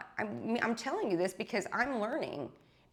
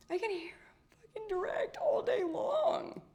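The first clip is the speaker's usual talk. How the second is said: exasperated outburst; 13 semitones higher than usual. Real, not acted. Exasperated about hearing God.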